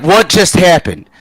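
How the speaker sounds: loud, high bewildered exclamation; stunned disbelief. Retold or imitated. Teller coming to over the beaten man, acted bewilderment. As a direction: blurt it out, baffled and loud. Retold shock after the fight.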